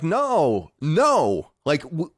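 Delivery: high, drawn-out exasperated no. Retold or imitated. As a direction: groan it out, slowly. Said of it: "And I'm like".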